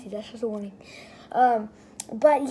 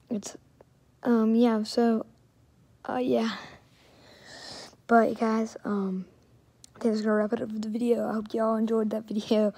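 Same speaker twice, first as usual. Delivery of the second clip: ordinary talk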